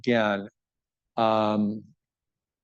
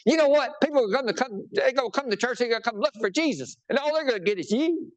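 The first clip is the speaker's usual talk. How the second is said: much higher put-on voice of an old teacher; imitating professor Don; 12 semitones higher than usual. Pastor quoting professor Don's warning about church, in a much higher put-on voice. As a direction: quote your old teacher, pitched way up. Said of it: "He said".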